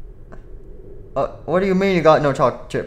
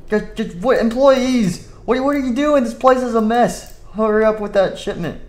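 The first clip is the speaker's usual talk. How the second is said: angry boss; 6 semitones higher than usual. Acted. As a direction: scold the lazy workers. Boss scolds employees.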